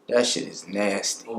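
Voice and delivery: low, quiet disgusted mutter. Retold or imitated. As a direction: sneer at the ketchup pizza. Classmates disgusted at ketchup pizza.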